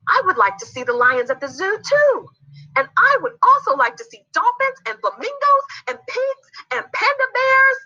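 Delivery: very high, eager little character; childlike excitement running away with itself; excited rambling. Acted. Z rambles on, forgetting turns.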